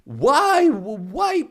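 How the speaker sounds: high, loud cry of disbelief; exasperated outburst. Real, not acted. Ranting at viewers about the news, a loud exasperated why.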